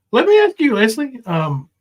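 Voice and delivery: ordinary talk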